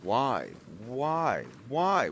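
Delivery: loud exasperated why; building outrage. Real, not acted. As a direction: climb into a yell. Repeated outraged question.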